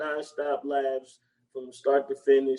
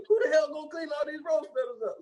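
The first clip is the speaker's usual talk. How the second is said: much higher, fast griping; high mock complaint; 11 semitones higher, faster than usual. Retelling the rose petal scene, fast high gripe. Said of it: "Dude was like".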